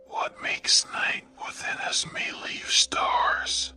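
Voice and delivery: ordinary talk, nothing marked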